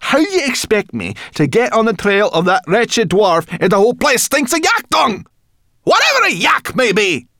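high, loud, exasperated rant; cross. A narrator's reading. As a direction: build to an outraged outburst. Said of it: "she said crossly".

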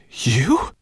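put-on startled, stammering boy; stammered. Ben to Twigleg, stammering in shock. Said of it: "stammered Ben".

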